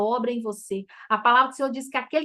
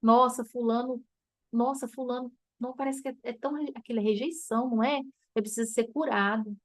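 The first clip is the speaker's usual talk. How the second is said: softer, gentle and understanding; compassionate; 5 dB quieter than usual. Shown as a demonstration. Preacher showing the healed way to speak of someone, soft and tender. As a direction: speak of the person with quiet compassion. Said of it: "Vai assim".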